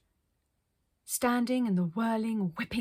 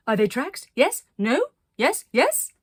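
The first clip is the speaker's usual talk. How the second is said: higher and louder with each eager yes; excited guessing; 6 semitones higher, 9 dB louder than usual. Acted. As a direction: bounce between yes and no, thrilled. Arcadia excitedly guessing at tracks.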